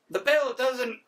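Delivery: high, botched first try at a stern English teacher; a failed stab at the teacher voice; a weak first attempt at a strict teacher; bad teacher voice. Shown as a demonstration. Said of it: "gonna do a better English, uh, English teacher voice".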